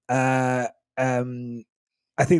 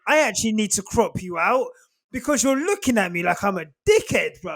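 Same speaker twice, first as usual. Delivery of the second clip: mock annoyed self; 6 semitones higher than usual. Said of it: "I was like".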